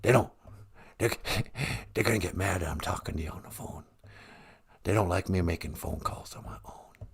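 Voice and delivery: low, hushed secretive murmur; Joe Biden. Acted. President hiding his phone call from handlers, hushed. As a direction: sink into a near whisper. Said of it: "you're whispering?".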